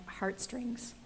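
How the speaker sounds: usual speaking voice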